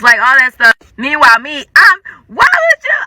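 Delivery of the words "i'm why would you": high, breaking sob of hurt; crying. Retold or imitated. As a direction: let the voice crack into tears. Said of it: "I'm crying".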